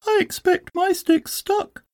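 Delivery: very high-pitched squeaky little Roo. A narrator's reading. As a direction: speak as tiny Roo, way up high. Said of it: "said Roo".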